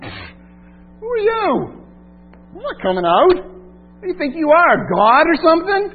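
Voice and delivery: high, loud, mocking demon voice, very slow at first; scoffing demon. The demon scoffing at Jesus, high mocking sneer. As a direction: sneer at him like a defiant demon. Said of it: "Does he, like, laugh at him, scoff at him".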